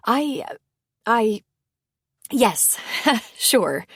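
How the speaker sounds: flustered stammer with a nervous laugh in it. A narrator's reading. Lauren accepting wine from Bo, stammering nervously with a laugh. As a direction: trip over the words, then laugh it off. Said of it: "she stammered".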